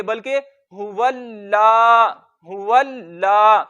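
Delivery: हुवल ला with a held, doubled ल before ला; doubled lam. Shown as a demonstration. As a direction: pause on the लाम in हुवल ला. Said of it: "लाम पे थोड़ा सा रुकेंगे". (लाम पर रुककर हुवल्ला)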